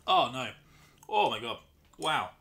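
talking through a sour candy; eating sour candy. Real, not acted. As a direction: mouth puckered on sour candy. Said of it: "I can't believe how sour this is".

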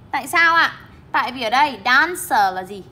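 normal talking voice

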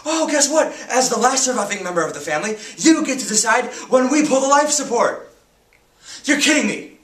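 high, loud, bitter protest; anguished outrage. Acted. Teenager told to decide brother's death, anguished outrage. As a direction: cry out against the unfairness. Outraged at life-support decision.

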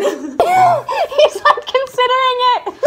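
high, loud laughing; laughing hard. Real, not acted. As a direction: crack up mid-sentence. Teased by friends.

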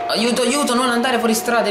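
high, alarmed plea as the car slides; racing game panic, begging the car to stay on track; panicked cry for help. Real, not acted. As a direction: yelp it high, losing control. Car about to leave road.

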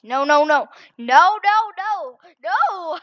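loud, rising string of cries; panicked shouting. Real, not acted. Nearly falling while getting coins.

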